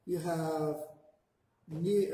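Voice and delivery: normal talking voice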